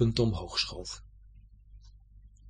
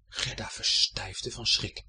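plain reading voice

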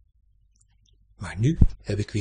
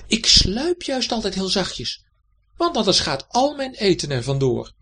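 much higher, louder cat voice calling back; 8 semitones higher, 3 dB louder than usual. A narrator's reading. Cat answering the hedgehog, high calling voice. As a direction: call it out as the sly little cat. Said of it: "riep Dibbes".

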